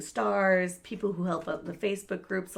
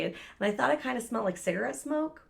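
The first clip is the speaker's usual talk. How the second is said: ordinary talk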